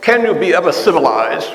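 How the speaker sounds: put-on haughty British accent; snooty mock-British accent; condescending British accent. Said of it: "their very accent is kind of condescending".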